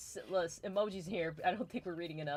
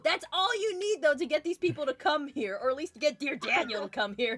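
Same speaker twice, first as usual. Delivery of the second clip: excited; 8 semitones higher, 8 dB louder than usual. Real, not acted. Laughing excited rant about pie.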